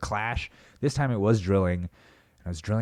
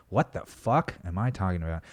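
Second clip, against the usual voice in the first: ordinary talk, nothing marked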